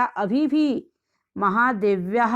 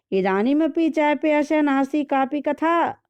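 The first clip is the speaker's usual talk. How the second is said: mock scolding; 6 semitones higher than usual. Mother-in-law scolds the daughter-in-law.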